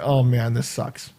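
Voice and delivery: soft, sinking grumble; patient stuck in the chair, grumbling softly to himself; glum mumble. Retold or imitated. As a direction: mutter it to yourself in misery. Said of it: "I'm just sitting there".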